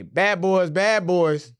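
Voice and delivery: loud, high sing-out warning; yelled out. Retold or imitated. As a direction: holler the theme-song line as a warning. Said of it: "I yell out, I say".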